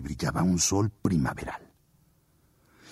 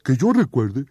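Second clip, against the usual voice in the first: oso suplicante y fuerte; suplicando; 5 semitones higher, 5 dB louder than usual. Acted. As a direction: ruega que le crean. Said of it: "El oso le suplicó". (oso suplicante)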